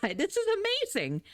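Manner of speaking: high thrilled gasp of delight; excited. Retold or imitated. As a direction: squeal it like a surprise gift. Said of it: "and you're like".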